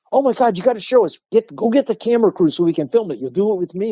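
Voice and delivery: high and fast, bursting with eagerness; eager excitement. Retold or imitated. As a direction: rush it out like an excited student. Dimmy eager to film new technique.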